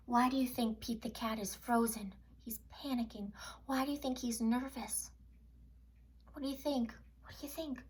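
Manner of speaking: soft hushed asking of the little listeners; storyteller's hushed suspense; hushed questions. Acted. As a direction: draw the children in with a whisper. Whispering questions to children.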